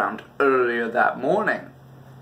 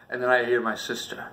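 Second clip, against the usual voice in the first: ordinary delivery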